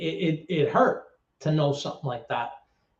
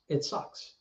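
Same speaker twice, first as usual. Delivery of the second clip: It sucks breathed out unvoiced, very soft; a real whispered repeat, voice gone; 9 dB quieter than usual. Real, not acted. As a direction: let it fall away to a whisper.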